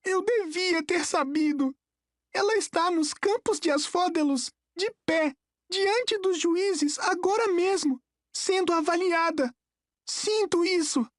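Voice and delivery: put-on high, strained boy's voice; bitter and high, phrase by phrase; trembling with fury. A narrator's reading. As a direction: hold the anger in a high, tight voice. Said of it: "tremia de fúria".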